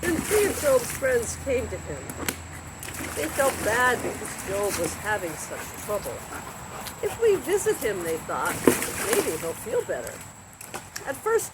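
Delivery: lively storybook reading with voiced characters; reading to children. A narrator's reading. Narrator reading a Bible story to children, lively. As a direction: read the Job story to kids like a bedtime tale.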